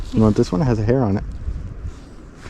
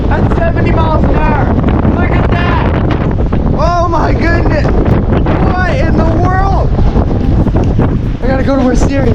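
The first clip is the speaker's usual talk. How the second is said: loud, high, thrilled exclamations; excited shouting; 11 semitones higher, 3 dB louder than usual. Real, not acted. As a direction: shout in amazement over the wind. Seventy mile an hour wind.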